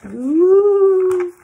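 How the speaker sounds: long, high, loud "ooh"; loud suspenseful ooh; spooky ooh. Acted. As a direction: draw out a high eerie ooh. Creaky door, suspense in story.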